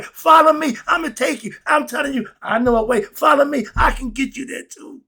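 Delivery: high, loud preaching call; fervent shout. Retold or imitated. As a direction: call them to follow at full force. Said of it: "I'm working up a steam today".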